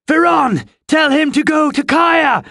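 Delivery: high, urgent shouted order; screaming. A narrator's reading. Jenk sending word past raging riverman, screaming an order. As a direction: yell instructions across a battle. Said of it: "Jenk was screaming".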